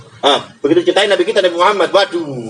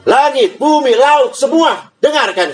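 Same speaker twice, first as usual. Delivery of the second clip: God calling out to sky, earth and sea; commanding call; 9 semitones higher, slower than usual. Acted. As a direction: call them all to attention. Allah summons heaven, earth, sea.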